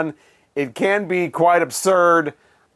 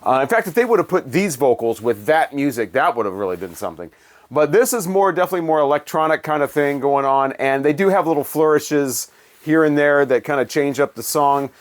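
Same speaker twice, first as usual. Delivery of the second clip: ordinary delivery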